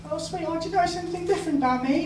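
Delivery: high, quick and sugary, a mock girlfriend; sweet girlfriend voice. Comic reading of his ex asking a teasing question, high and sugary. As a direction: coo it fast like a fond partner.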